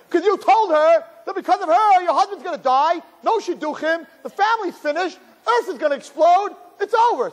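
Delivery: high, escalating doom list; exasperated rant. Acted. Answering parents why their child is depressed, exasperated rant. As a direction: pile up the catastrophes hysterically.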